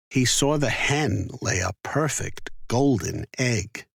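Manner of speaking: plain reading voice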